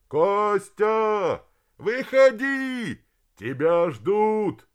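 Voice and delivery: loud, high, long-drawn calls; calling out. A narrator's reading. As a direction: holler for someone missing, stretching each word. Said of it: "Начали звать ботаника хором и по отдельности". (протяжно звать криком)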